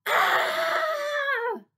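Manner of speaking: very high, loud sustained scream; full-force child's audition scream; all-out scream. A narrator's reading. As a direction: scream with everything you have. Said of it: "gave everything I had".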